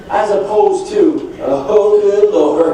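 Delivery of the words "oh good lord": a dismayed groan of a customer; loud dismay. Retold or imitated. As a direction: exclaim it louder, exasperated.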